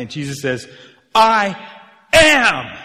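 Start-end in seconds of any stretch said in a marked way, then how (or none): 1.1-2.8 two high, booming separate words; loud, grand declaration; emphatic proclamation. Retold or imitated. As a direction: thunder it out with a pause between. Quoting Jesus' great declaration.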